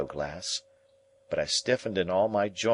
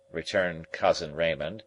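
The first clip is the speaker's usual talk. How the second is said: plain reading voice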